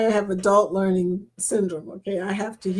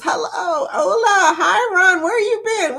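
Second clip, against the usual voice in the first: excited greeting; 9 semitones higher, 4 dB louder than usual. Retold or imitated. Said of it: "Ron says".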